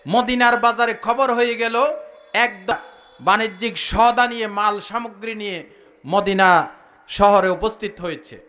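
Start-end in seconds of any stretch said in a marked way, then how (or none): none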